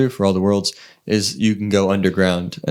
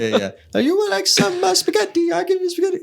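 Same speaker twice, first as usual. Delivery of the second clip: very high cartoon Italian American; high, hammy Italian-American voice; cartoon Italian accent. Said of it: "cartoonish Italian American".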